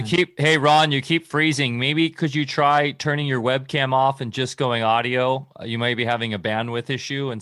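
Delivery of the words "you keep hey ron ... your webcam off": calling out. Real, not acted. Calling to a frozen caller.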